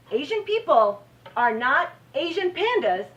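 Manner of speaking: high, loud, forceful declaration; indignant protest. Real, not acted. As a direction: drive the point home in real indignation. Speaker protests racial stereotype.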